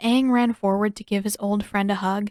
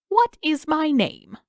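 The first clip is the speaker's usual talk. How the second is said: higher, slow, teasing king; teasing riddle; 8 semitones higher, 4 dB louder, slower than usual. A narrator's reading. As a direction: drag it out, teasing. King's drawn-out riddle.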